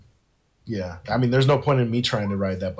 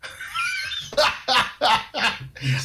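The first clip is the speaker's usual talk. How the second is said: high nervous giggle; nervous laugh; 14 semitones higher than usual. Real, not acted. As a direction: laugh nervously at the bad roll. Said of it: "that's nervous laughter".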